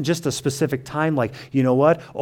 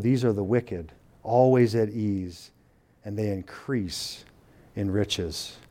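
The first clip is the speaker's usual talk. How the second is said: scripture reading; 4 semitones lower, 6 dB quieter than usual. Acted. Pastor reads psalm aloud.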